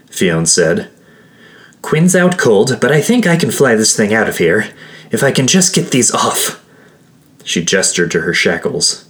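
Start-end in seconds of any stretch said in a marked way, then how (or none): 1.8-6.6 higher-pitched urgent character voice; urgent companion. Acted. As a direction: press them to get moving, pitched up. Fionn urgently plans the escape.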